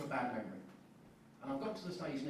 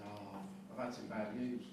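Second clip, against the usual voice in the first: glum reply; 6 semitones lower, 4 dB quieter, slower than usual. Retold or imitated. Friend's sombre reply.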